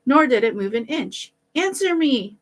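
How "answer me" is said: high, sharp demand; shouting. A narrator's reading. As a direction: order the figure to answer. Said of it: "the boy shouted".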